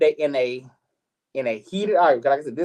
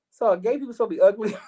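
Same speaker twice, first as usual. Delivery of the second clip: incredulous, laughing question; tossing it back with a laugh; laughing retort; 8 semitones higher, faster than usual. Retold or imitated. To the grandmother, a laughing incredulous retort. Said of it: "And I'm like".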